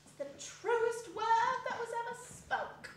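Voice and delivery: laundress. Acted. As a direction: agree heartily. Dilber agrees.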